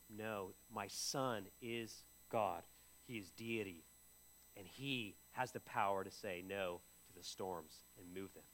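low, slow, hushed divine voice; solemn low voice of God. Retold or imitated. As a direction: speak as God, low and soft. God's reply voiced.

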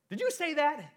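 high, loud, startled demand; hissed question. A narrator's reading. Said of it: "he hissed at the parrot".

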